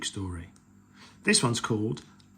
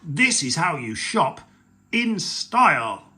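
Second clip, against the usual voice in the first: loud, stretched-out finish on "style"; mildly hammed-up boast, rising in energy; showy cry; 4 semitones higher, 6 dB louder than usual. A narrator's reading. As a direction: show off proudly to the children. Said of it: "he cried".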